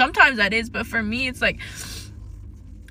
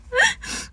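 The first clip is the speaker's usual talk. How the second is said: rueful laugh; 14 semitones higher than usual. Real, not acted. Laughs at own trauma answer.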